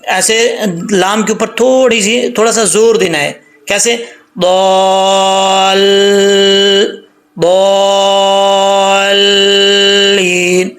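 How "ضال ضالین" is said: ضالین drawn out slowly, ض held long into the ل; slow tajweed chant of ضالین; Quranic recitation. Shown as a demonstration. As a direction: chant ضال then ضالین slowly, stretching the ض. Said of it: "ضاد کو لمبا کر کے لام کے ساتھ connect کریں".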